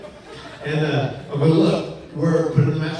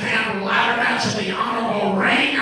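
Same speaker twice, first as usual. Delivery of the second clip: angry Vince; 8 semitones higher than usual. Retold or imitated. Vince yelling at Matt on the phone, high and angry. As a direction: rant down the phone like an irate boss. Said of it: "and he goes".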